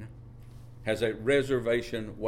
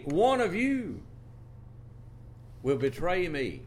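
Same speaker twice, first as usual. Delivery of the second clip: high, slow, grave accusation; preacher imagines the Lord confronting the disciples; voicing the Lord; 8 semitones higher than usual. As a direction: let each word land like a verdict. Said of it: "if the Lord were to look".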